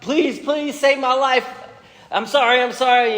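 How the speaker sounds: high, pleading, hammed-up begging; a preacher acting out a panicked Haman begging the queen. Haman voiced begging for his life.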